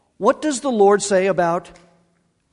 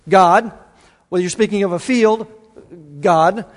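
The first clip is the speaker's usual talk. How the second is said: ordinary delivery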